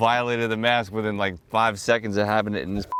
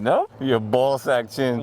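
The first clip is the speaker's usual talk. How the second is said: ordinary talk, nothing marked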